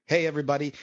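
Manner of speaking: normal talking voice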